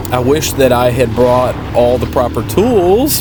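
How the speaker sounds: ordinary talk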